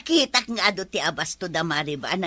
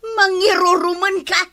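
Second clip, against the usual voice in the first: angry; 9 semitones higher, 6 dB louder than usual. Acted. A heated accusation in the quarrel.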